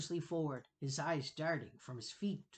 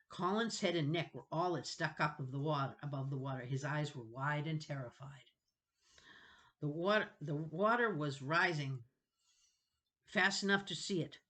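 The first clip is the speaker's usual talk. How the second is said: ordinary delivery, nothing marked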